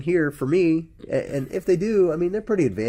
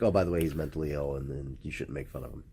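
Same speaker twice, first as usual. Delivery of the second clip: much lower, fading into a soft mumble; mock killjoy; 12 semitones lower, 11 dB quieter than usual. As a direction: imitate a buzzkill warning, dropping away. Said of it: "and then you're like".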